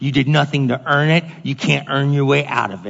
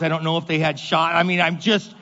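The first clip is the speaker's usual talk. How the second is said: ordinary talk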